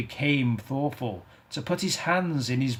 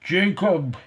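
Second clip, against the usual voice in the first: imploring; 6 semitones higher, 5 dB louder than usual. Acted. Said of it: "he said imploringly".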